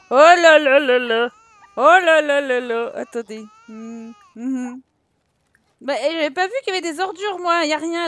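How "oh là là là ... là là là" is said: slow, loud drawn-out lament; dismayed wail. Real, not acted. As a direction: wail over the chaos. Chaos with babies crying. (lamentation lente et forte)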